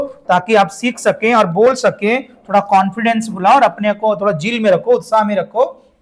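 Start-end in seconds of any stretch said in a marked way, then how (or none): none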